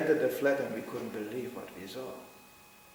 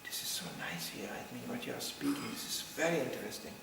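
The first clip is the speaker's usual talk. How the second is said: imitating an intense boy, very soft and low; hushed, earnest retold confession; intense hushed confiding; 7 semitones lower, 7 dB quieter than usual. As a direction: confide it in a near-whisper. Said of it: "looked at me very intense, said".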